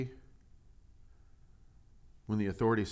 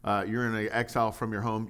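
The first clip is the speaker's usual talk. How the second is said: normal talking voice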